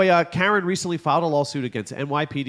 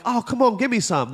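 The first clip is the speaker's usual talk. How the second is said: begging; 10 semitones higher, 4 dB louder than usual. Retold or imitated. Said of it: "Y'all like".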